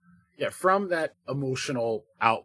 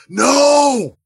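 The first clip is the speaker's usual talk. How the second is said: sudden shrill scream of terror, much higher and louder; terrified scream; 14 semitones higher, 14 dB louder than usual. Acted. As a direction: scream it as if waking into a nightmare. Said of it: "he just sits up screaming".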